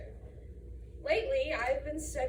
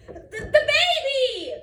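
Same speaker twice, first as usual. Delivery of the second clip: high, loud, drawn-out cry of alarm; frantic mother's yell; alarmed mom yelling; 9 semitones higher, 11 dB louder, slower than usual. Acted. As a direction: shout in panic for the baby. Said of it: "My mom thinks I should say".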